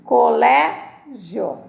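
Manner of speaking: "Colégio" slowly as co-LÉ, gio; "Colégio" with a pause before joined gio; ending not separated. Shown as a demonstration. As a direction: stretch "Colégio", the gio in one beat. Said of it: "Essa não separa".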